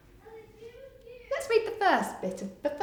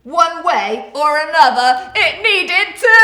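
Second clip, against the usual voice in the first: lively rhyming storybook read, loud at the start; reading to children; 3 semitones higher, 13 dB louder, slower than usual. Acted. Teacher reading a picture book to children, lively. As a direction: read the rhyme aloud to little ones with bounce.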